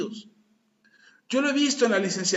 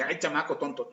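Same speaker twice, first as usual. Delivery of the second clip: mock scolding; 6 semitones lower, 6 dB quieter than usual. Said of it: "No es cierto, no dije eso".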